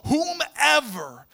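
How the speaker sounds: usual speaking voice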